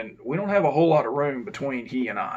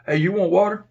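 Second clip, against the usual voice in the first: calling out; 6 semitones higher, 7 dB louder than usual. Retold or imitated. Calling into a cell.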